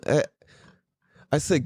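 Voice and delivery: usual speaking voice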